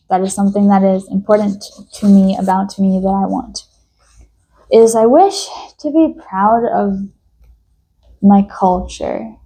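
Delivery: ordinary talk, nothing marked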